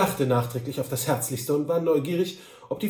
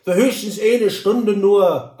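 high, loud, slow dialect voice; Tante Droll; 6 semitones higher, 9 dB louder, slower than usual. A narrator's reading. Droll reassures the Kantor, confident dialect voice. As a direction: answer confidently in Saxon-flavored dialect. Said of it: "antwortete Tante Droll".

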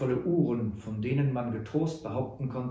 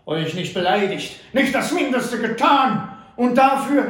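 a bound captive's growing outrage; grim outburst; 8 semitones higher, 8 dB louder than usual. A narrator's reading. Tied-up Butler rages at his captors, grim and loud. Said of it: "rief Butler grimmig".

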